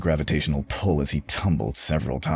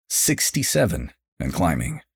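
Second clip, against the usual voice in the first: usual speaking voice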